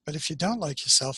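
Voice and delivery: ordinary talk, nothing marked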